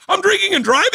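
a high incredulous yelp; shocked surprise. Retold or imitated. Driver finding a beer in his lap, high shocked squeak. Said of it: "You're like".

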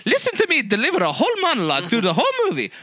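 high, hammed-up Italian Mario accent; bouncy cartoon Italian accent, way up high; mocking Chris Pratt doing Mario in an Italian accent. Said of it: "would you really want to hear".

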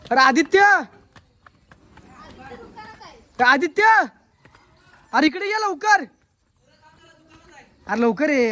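calling out. Real, not acted. Calling Aditya from afar. (आदित्यला दूरवरून मोठ्याने हाक मारणे)

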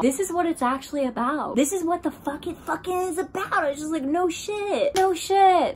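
high, excited outburst; amazed excitement. Retold or imitated. Reliving the realization after kissing her, amazed and excited. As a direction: blurt it out high, thrilled by the revelation.

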